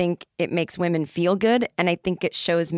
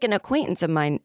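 ordinary talk

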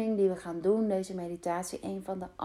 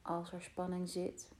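guided meditation. Acted. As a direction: guide them calmly into rest. Leads a meditation. (rustige meditatiestem)

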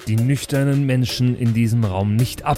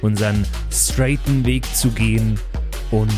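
schoolteacher. Acted. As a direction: lecture them with deliberate pauses. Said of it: "du hörst dich an wie alle meine Mittelschullehrer gemeinsam". (eindringlicher Lehrer)

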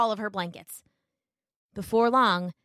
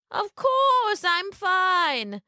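slow, creaky fake grandmother; a wolf feigning a sick old lady; wolf posing as granny; 11 semitones higher, 3 dB louder, much slower than usual. Wolf tricking Little Red, faking a sick granny.

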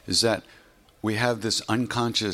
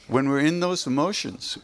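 normal talking voice

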